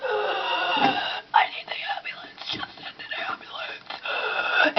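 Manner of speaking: high, slow, breathy whispered panic; imitating a panicked gasping plea; freaking out. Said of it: "me freaking out like".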